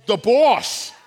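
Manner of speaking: loud, punchy shout of a star's nickname; hyped-up announcement. Real, not acted. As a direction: boom it out like a fan. Hyping up [name]'s nickname.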